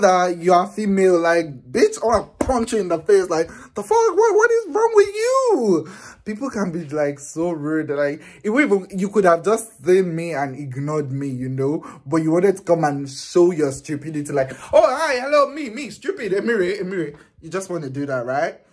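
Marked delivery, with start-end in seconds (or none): 3.9-5.9 high, slow, laughing disbelief; amused vlogger mocking a rude fan, high and laughing; laughing outrage. Real, not acted. 14.7-17.2 high, silly mock-fan voice. Mocking the fan's behavior.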